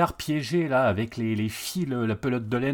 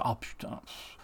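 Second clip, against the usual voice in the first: weary sigh; 4 semitones lower, 14 dB quieter, slower than usual. Retold or imitated. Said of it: "tu fais".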